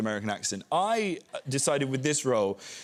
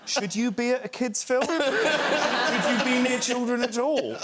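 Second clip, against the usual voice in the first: incredulous; 11 semitones higher, 4 dB louder than usual. Retold or imitated. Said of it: "I was like".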